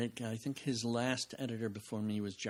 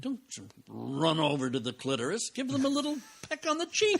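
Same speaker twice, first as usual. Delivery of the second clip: hammed-up British schoolmaster voice, pitch climbing with a laugh; Meaning of Life quote; 6 semitones higher, 6 dB louder than usual. Quoting the film to a podcast host, put-on voice. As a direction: play the lecturing teacher, breaking into laughter. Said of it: "that bit in, um, The Meaning of Life".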